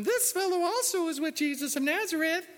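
put-on high falsetto girl's accusation; preacher voicing a girl who accuses Peter, in falsetto; second girl's voice. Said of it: "That's exactly how she sounded".

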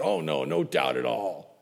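a hint of a cocky player brushing it off; breezy fake-modest athlete, lightly played; lightly voiced athlete. Athlete claims no doubt.

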